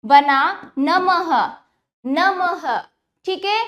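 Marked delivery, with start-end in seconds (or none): 0.8-2.8 नमः drawn out, ending in हा; final visarga as ha. Shown as a demonstration. Teacher showing children the visarga, final h sound. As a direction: say नमः slowly with an audible final ha. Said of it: "हा का उच्चारण हो जाता है".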